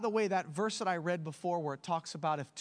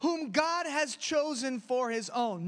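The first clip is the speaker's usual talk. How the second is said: preaching; 10 semitones higher, slower than usual. Acted. Reading scripture with force.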